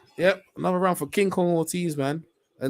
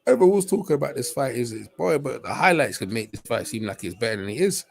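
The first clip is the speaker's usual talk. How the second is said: normal talking voice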